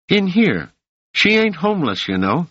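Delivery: put-on high, cocky street kid; street boy Rache. A narrator's reading. Rache's voice in the audiobook.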